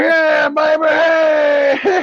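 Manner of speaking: put-on high, loud drunk hollering with a laugh; drunk whooping. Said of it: "I'm like".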